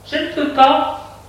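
high-pitched servant's refusal; maid. A narrator's reading. Maid refuses through the door. (bonne à la voix haute)

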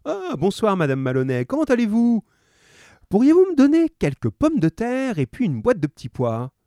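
high, lightly voiced shopkeeper; friendly grocer. A narrator's reading. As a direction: chat like a helpful grocer. Grocer greets Mrs Maloney.

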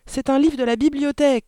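put-on high, loud child's protest; crying out. A narrator's reading. Daughter protesting as her book is torn, shouting. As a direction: shout it out in alarm. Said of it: "cria Mathilda".

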